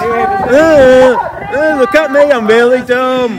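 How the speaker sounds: high, mock-stupid grunting; mocking dumb voice. Vlogger mocking a protester behind a flag, high dumb voice. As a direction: play the dimwit to mock him.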